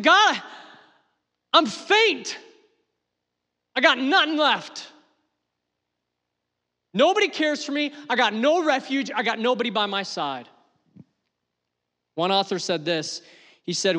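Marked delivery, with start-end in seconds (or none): 0.0-10.5 high, loud desperate wail to God, easing toward the end; crying out. Acted. Fleeing David in a cave crying to God, anguished. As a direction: cry out as if hunted and utterly alone. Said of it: "he's crying. He's like".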